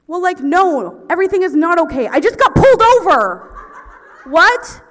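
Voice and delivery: high, hammed-up yelling; exasperated outburst. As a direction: shout back as if the question were absurd. Imagined retort to the cop.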